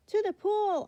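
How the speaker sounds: high, loud yell. Acted. Boy yells to the firemen, urgent. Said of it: "I yelled".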